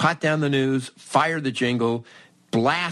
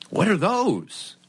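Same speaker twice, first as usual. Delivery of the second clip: mock clueless; 11 semitones higher, slower than usual. Feigning ignorance of cartridges.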